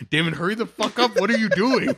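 dad's impatient shout, retold; imitated urgent yell. Acts out dad urgently yelling at him.

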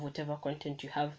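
ordinary delivery

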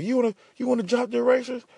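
high, sneering voice of a mean teacher; lightly shrill imitation of the teacher's taunt; mocking teacher. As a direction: mimic her taunt, nasty and high. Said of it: "she said some smart shit like".

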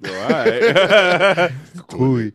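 laughing. Real, not acted. Still laughing after the noise.

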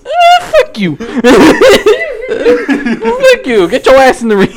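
high, loud laughing yell; laughing shout. Retold or imitated. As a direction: yell it while cracking up. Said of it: "then he's like".

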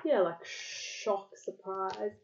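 usual speaking voice

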